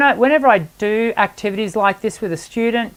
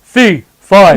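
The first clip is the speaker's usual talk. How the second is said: much louder booming giant; 8 dB louder than usual. Shown as a demonstration. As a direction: boom it out. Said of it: "the giant from Jack and the Beanstalk".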